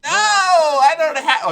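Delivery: high, loud and drawn out; loud protest. Real, not acted. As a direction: shout the refusal, stretched. Protesting loudly at melee-only event.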